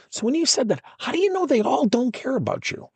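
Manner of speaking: high-pitched retold challenge; challenging question. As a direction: press for proof with voice pitched well up. Acted challenge to the young lady.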